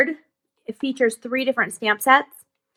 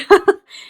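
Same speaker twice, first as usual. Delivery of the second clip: bright laugh; 10 semitones higher, 9 dB louder than usual. Real, not acted. Laughs after the word-of-the-day bit.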